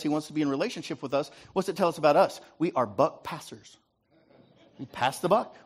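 ordinary talk, nothing marked

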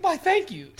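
high, prim mock thank-you; hammed-up gracious thanks. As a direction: play it flattered and prim. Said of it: "He's like".

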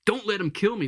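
high-pitched acted plea; begging. Lizard pleading with the ghost for its life, begging. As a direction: plead desperately, voice pitched well up. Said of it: "The lizard's begging".

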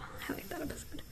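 whisper. Real, not acted. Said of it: "aside under the other speaker".

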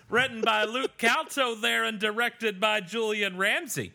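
high, hammed-up Canadian accent; lilting put-on Canadian voice. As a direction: read credits in a mock Canadian accent. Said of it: "do the rest of the episode in that accent".

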